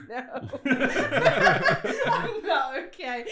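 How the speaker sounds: giggling answer, slow; cracking up while answering; laughing. Retold or imitated. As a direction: gasp it out mid-giggle. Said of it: "And I'm just like".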